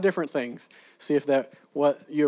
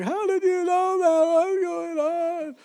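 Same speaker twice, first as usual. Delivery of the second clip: much higher, slow, amazed and choked up; overwhelmed stranger; 3 dB louder, much slower than usual. Retold or imitated. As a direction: imitate someone in tears asking how you knew. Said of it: "they're weeping".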